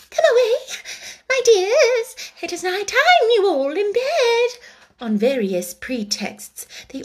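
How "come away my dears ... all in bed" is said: very high, loud, anxious bird voice; trembling canary. A narrator's reading. The canary calling its children home, in a high trembling voice. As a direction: call the chicks away in alarm. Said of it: "in a trembling voice".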